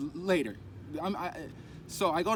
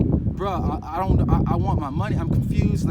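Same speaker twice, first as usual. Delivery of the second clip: louder, fed-up push-back, voice raised; exasperated retort; 9 dB louder than usual. Retold or imitated. Demanding money back from the mentor, loud exasperated retort. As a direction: insist hard, worn out and baffled.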